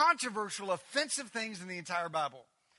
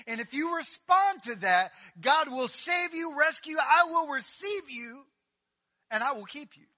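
fervent preaching; 6 semitones higher, 10 dB louder than usual. Acted. Preaching Jesus' words at full force.

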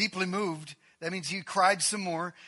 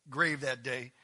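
ordinary delivery

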